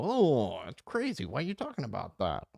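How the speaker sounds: high, whiny mock disbelief. Mimicking people who dodge the Epstein question, high and mocking. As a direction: swoop up high in fake bewilderment. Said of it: "They don't go".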